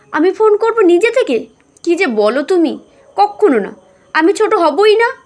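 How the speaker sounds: high, loud proud protest; defiant refusal. Acted. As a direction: flatly refuse to give in. Defiant daughter refuses to call.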